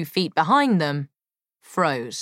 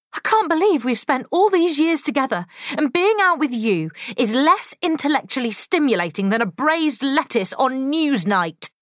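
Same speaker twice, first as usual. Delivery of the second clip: exasperated woman; 8 semitones higher than usual. Acted. Molly's raised exasperated rant.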